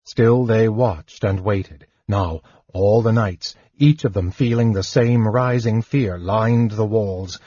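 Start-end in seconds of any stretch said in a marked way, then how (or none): none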